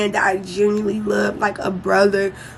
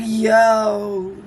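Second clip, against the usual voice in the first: stunned disbelief; much slower than usual. Retold or imitated. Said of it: "I'm like".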